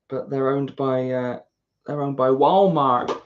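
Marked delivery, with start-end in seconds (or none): 1.9-3.2 slow, louder amateur American accent; badly faked American accent on Walmart; attempted American accent. Shown as a demonstration. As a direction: draw out a clumsy American accent. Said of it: "that's why I don't do accents".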